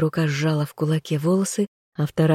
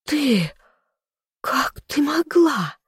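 crying in betrayal; 10 semitones higher, slower than usual. A narrator's reading. Betrayed heroine cries out.

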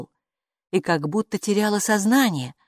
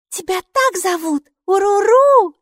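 put-on high, louder child's delight; thrilled the creature answered; 8 dB louder, slower than usual. Майки, маленькая девочка, радостно спрашивает Буку. Maiki excited.

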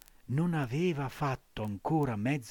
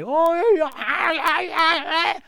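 very high, loud panicked squawks; a bird being grabbed; bird's cries; 12 dB louder, slower than usual. A narrator's reading. Blackbird seized by cat.

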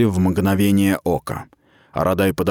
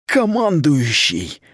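slow, higher pained hiss; 6 semitones higher, slower than usual. Acted. Wounded Mita hisses at the commander in pain. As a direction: force the word out through pain. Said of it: "зашипела от боли Мита".

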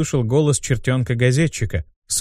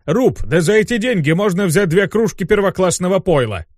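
higher, protesting grumble; indignant; 6 semitones higher than usual. Acted. Demon arguing drink is better value, indignant. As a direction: protest the waste of money. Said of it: "возмутился Абдусциус".

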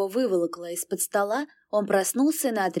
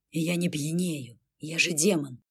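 lightly voiced demon; 4 semitones lower, 4 dB quieter than usual. A narrator's reading. Demon says he's sober.

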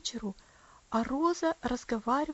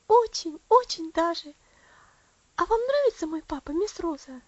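put-on high, loud child's voice; eager little girl; 7 semitones higher, 7 dB louder than usual. Little Elsie asking Rosa about her papa, eagerly. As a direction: answer as a delighted little girl, pitched up.

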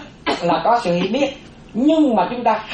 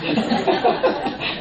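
light breathy laugh; 6 semitones higher, 3 dB quieter than usual. Real, not acted. Monk laughs after teasing.

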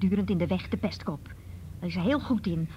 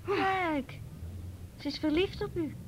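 mocking; 10 semitones higher, slower than usual. Moeder spot over verliefde dochter.

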